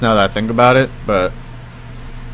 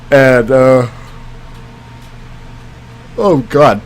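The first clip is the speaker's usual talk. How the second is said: talking through a yawn; real yawning; slower than usual. Real, not acted. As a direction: let a yawn stretch the words. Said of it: "I'm yawning".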